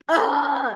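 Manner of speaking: high, loud exasperated ah; a sharp cry of frustration; frustrated cry. Retold or imitated. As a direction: burst out in frustration. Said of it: "I'm like".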